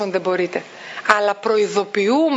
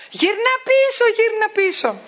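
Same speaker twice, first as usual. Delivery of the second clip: put-on high call after a child through the door; raised calling voice; 11 semitones higher than usual. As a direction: call him back, pitched way up. Mother calling her son back.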